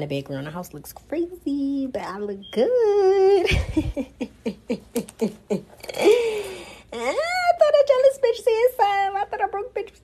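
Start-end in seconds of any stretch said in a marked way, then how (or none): 2.7-6.9 high, loud cackling laugh. Real, not acted. Laughs at her own look. 6.9-10.0 much higher, louder sassy taunt; sassy mock voice. Sassy jab at imagined haters.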